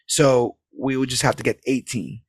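usual speaking voice

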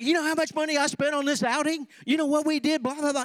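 high, loud, fast hammed-up rant; exasperated dad yelling at the kids, hammed up; mock ranting dad. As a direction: rant about the money spent on the outing. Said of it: "And you're like".

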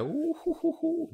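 high falsetto "ooh-hoo-hoo"; playful high hoots; gleeful hooting. Retold or imitated. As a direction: coo with giddy anticipation. Retold glee while waiting.

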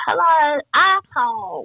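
put-on high, loud tiny-child greeting; toddler voice. Mother imitating daughter greeting someone at preschool in tiny voice. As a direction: chirp it like a cheeky little kid. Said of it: "it's kind of".

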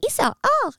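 high anime battle yelp; anime fight cry. Shown as a demonstration. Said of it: "make noises like".

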